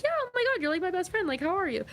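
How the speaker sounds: put-on high, squealing "oh my God" to an old friend; gushing reunion. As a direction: burst out in delight at a friend you haven't called. Gushing at a friend after months.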